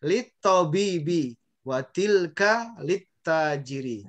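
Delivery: slow "Lit tobibi", "lit tajiri", doubled t and long vowels; teacher demonstrating "lit tajiri" slowly, lam silent; model Arabic reading. Shown as a demonstration. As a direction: measure out each syllable of "Watilka lit tajiri". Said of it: "bacanya ini ya, perhatikan".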